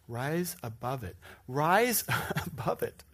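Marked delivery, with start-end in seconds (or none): 1.5-3.0 laughing, louder. Shown as a demonstration. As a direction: rise above it with a laugh. Laughing emphatic repeat.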